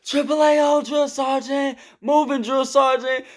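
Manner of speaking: high, loud military response; platoon shout. Retold or imitated. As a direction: bark it back like a recruit.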